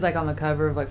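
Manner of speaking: normal talking voice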